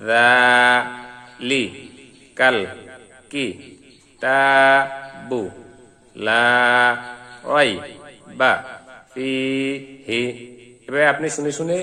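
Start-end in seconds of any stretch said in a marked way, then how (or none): 0.1-10.2 broken-up syllables, each one set apart by a pause; slow separated syllable drill. Shown as a demonstration. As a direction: say each syllable alone, then stop. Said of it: "আবার".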